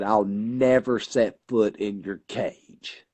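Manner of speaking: slow, measured defiance; retold defiant vow. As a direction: draw out each word of the retold vow.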